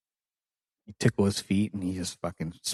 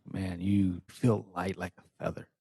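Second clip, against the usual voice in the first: unstrained, normal talk; 2 semitones lower, 5 dB quieter than usual. Retold or imitated. Coach needling a partner trying to be heavy, unstrained. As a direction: tease softly with no strain at all. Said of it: "there's no strain in my voice".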